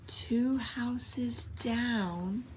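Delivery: slow, drawn-out whisper. A narrator's reading. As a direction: breathe it out slowly for suspense. Dramatic whisper for distance.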